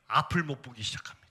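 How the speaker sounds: hushed, low near-whisper; 숨죽인 서술. Real, not acted. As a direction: drop suddenly to a low, breathy hush. Dramatic drop in testimony. (숨죽여 낮게 속삭이듯)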